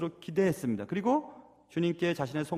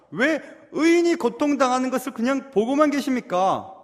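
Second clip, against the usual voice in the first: higher, mounting acted indignation; complaint building louder to the end; resentful protest to God; 4 semitones higher, 6 dB louder than usual. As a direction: challenge God with aggrieved questions. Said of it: "사람에 대한 원망이 주님에까지".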